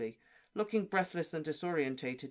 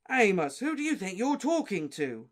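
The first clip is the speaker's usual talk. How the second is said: loud, higher angry rebuke; 6 semitones higher, 7 dB louder than usual. Acted. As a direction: demand it furiously. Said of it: "said Mister Weasley very angrily".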